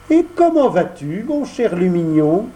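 put-on feigned innocence, puppet boy; voix de pantin. Pinocchio feint l'innocence. (voix de pantin faussement innocente)

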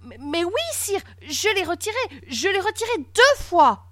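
high-pitched, anxious stammer; flustered protest. A narrator's reading. Boy protests to furious king. (protestation affolée, voix aiguë)

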